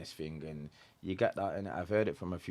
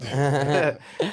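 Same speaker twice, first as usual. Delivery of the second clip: loud laugh breaking out at himself; laughing at his own bad impression; burst of laughter; 9 semitones higher, 13 dB louder than usual. Real, not acted. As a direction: crack up laughing at the failed accent. Laughs at own failed accent.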